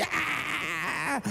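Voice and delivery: put-on high tantrum shriek of a spoiled child; mock tantrum scream. Spoiled child falling on mall floor, mock shriek. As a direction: scream like a kid throwing a fit.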